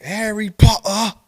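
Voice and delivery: high, slow "Potter" with no T; exaggerated British "Potter", T swallowed; British dropped T. Shown as a demonstration. Correcting a friend, "Potter" without its T. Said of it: "they don't say Potter".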